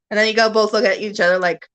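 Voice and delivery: ordinary talk, nothing marked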